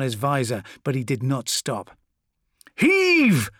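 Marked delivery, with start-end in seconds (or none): 2.8-3.5 very high, loud heaving shout; cried command. A narrator's reading. Corvo urging men pushing the statue, straining cry. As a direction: cry out to drive the push. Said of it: "cried Corvo".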